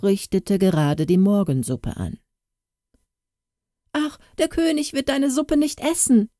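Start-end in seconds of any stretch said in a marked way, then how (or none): 3.9-6.3 put-on high, urgent girl's voice; urgent cry. Katrin bursting into the kitchen to warn the cook, urgent high cry. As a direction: rush to bring the bad news. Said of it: "rief sie".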